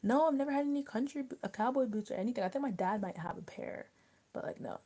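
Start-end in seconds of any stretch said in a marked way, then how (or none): none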